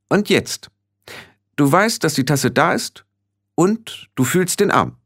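hohe, eindringliche Stimme mit Pausen; anleitende Hexe. Acted. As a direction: Schritt für Schritt bestimmt führen. (beschwörend anleitende Hexe)

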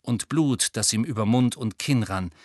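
ordinary delivery

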